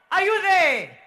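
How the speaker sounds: loud, high roll-call shout. Real, not acted. MC calls out to Zambia's team, shouting. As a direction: shout it across to the crowd.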